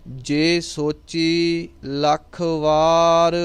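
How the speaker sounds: very slow, sustained chant with long vowels; scripture recitation. Shown as a demonstration. Teacher reciting Japji for students, slow measured chant. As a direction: draw out each line on a level, held tone.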